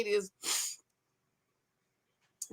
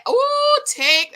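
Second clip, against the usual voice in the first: excited squeal; 14 semitones higher, 8 dB louder than usual. Real, not acted. Excited reaction to a comment.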